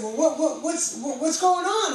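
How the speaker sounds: loud, high stammering what; baffled friends demanding answers; confused questioning. Retold or imitated. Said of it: "And they said".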